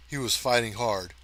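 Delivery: usual speaking voice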